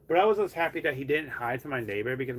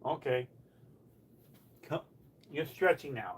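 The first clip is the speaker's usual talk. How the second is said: ordinary talk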